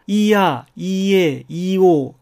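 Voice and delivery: イーアー、イーエー、イーオー said slowly as separate vowel pairs; Spanish ia ie io sounds. Shown as a demonstration. Teacher sounding out イーアー、イーエー、イーオー for students. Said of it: "それが、".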